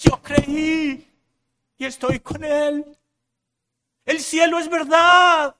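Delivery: high, loud, drawn-out cries of jubilation; Pentecostal shout. Acted. Believer arriving in heaven on that day, jubilant Pentecostal shout. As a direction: shout it out with long ringing endings. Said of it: "Yo voy a gritar, no sé ustedes, un, un grito pentecostal".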